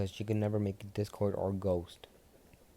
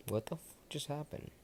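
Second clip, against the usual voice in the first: stunned aside; 7 semitones higher, 7 dB quieter than usual. Acted.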